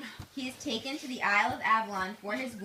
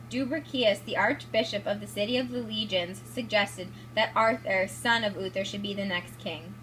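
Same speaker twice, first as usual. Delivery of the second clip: ordinary talk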